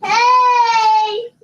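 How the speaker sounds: put-on high sing-song hey to a baby; baby greeting.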